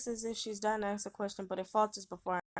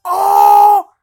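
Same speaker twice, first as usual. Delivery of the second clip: sudden loud cry of shock; shocked shout; 12 semitones higher, 31 dB louder than usual. Real, not acted. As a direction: blurt it out, caught off guard. Shocked reaction while playing.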